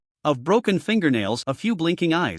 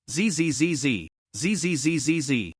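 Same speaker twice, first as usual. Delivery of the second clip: creaking floor sounds. A narrator's reading. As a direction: creak out each footstep on the boards. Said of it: "producing a series of creaking sounds".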